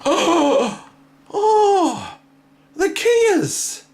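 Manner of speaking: thrilled to spot the birds; high squeal of surprise at the birds; delighted surprise. Real, not acted. Sees the birds on screen.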